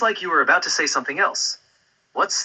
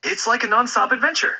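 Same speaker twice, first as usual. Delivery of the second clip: higher, brighter excited voice; more excited; 7 semitones higher than usual. Pretend. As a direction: raise the energy, pitch lifted. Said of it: "even more excited than that".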